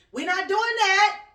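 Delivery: sharp loud refusal, high; loud high veto. Real, not acted. To the Renaissance-outfit crowd, refusing, loud and high. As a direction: shut it down loudly.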